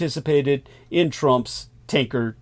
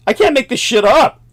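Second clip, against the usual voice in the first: exasperated; 8 semitones higher, 6 dB louder than usual. Real, not acted. Exasperated outburst.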